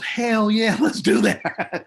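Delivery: high, laughing hell yeah; excited yes. Retold or imitated. Producer approving the wrestling pitch on the phone, high and excited. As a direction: producer loving the idea, high and bubbly.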